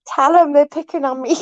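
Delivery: high mock-complaint to a protector; playful tattling. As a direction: play the picked-on girlfriend telling on someone. Acted plea to a protective man.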